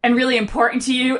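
usual speaking voice

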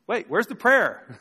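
mock bewildered onlooker; mock puzzled. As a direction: ham up the confusion, searching for the missing prayer. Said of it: "It's like".

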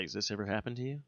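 a soft, hushed question; whispering to friends in a spooky library. Retold or imitated. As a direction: ask it under your breath. Venkman asks quietly.

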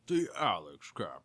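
booming show-open announcer voice; announcer intro. Acted. Opening their podcast to listeners, big announcer voice. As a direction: present the show name like a radio host.

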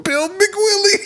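name blurted high and laughing; real laughing shout; shrieking laughter. Real, not acted. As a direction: yelp the name mid-giggle. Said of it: "I can't stop laughing".

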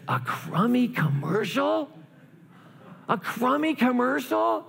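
high, slow, rising disbelief; incredulous boy. Acted. Boy reading the secret message, in high-pitched disbelief. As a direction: draw it out high as an indignant question. Acts the boy's disappointed disbelief.

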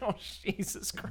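breathy, unvoiced aside; mock whisper. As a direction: hush it like you're afraid to be overheard.

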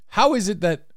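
normal talking voice

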